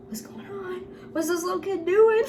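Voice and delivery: high-pitched mock gossip, breaking into a laugh; nosy onlooker. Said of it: "are whispering".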